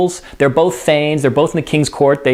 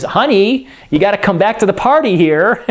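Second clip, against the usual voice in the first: higher, lightly acted coaxing wife; Lady Macbeth; 5 semitones higher than usual. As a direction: call your husband back, pitched up. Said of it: "it's Lady Macbeth who says".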